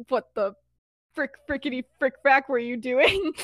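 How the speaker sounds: half-hearted retold telling-off; mild mock yell. Remus yelling at Sirius after the stunt, mild mock yell. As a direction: scold lightly, without a full shout. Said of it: "just yelling at him".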